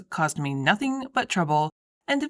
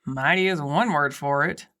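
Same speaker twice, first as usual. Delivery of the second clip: low, dry ghost voice; 6 semitones lower than usual. A narrator's reading. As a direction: sneer it as the ghost. The ghost Davina mocks.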